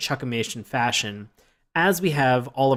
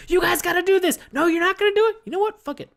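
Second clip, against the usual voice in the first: much higher, loud, fast-changing chief; energetic chief flip-flop; 4 dB louder, much faster than usual. Retold or imitated. Host imitating the chief flipping, high energetic mock. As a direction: bark then drop it with a squeaky shrug. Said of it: "speaking with so much energy and conviction".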